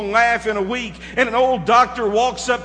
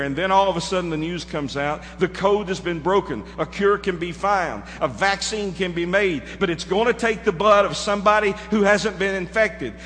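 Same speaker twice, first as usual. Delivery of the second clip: normal talking voice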